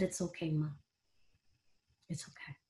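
very soft, fading reassurance. Retold or imitated. Daughter soothing her dying mother, very softly. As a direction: hush it down to near nothing. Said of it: "And I said".

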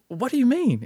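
high-pitched retold bewilderment; baffled. Man questioning a shopper's warning, high baffled retelling.

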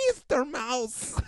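slow, high squeak; high squeaky mouse voice. Acted. A new squeaky character.

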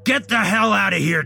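high, loud order to leave; furious shout. A narrator's reading. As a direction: bellow him out of the room. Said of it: "Mister Pendleton shouted".

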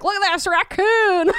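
put-on playful French accent. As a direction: say it like an excited French trader. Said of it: "that was a great French accent".

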